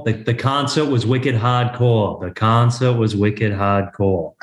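put-on quoting an ad in a Boston accent; dropped-r Boston accent. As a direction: lay on broad Boston vowels. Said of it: "that old Southwest commercial".

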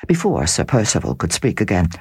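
ordinary delivery, nothing marked

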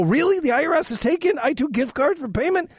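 high-pitched disbelieving question; mock incredulous. Man questions phone scammer's payment method, feigned disbelief. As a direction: pitch it way up, playing astonished at the demand.

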